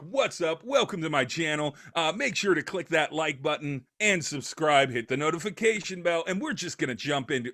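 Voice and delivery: high, bright-eyed channel greeting; hyped YouTuber intro. Retold or imitated. Mocking a YouTuber's hyped intro to his podcast cohost. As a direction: pump up the intro like an old-school YouTuber. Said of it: "like, that kinda energy".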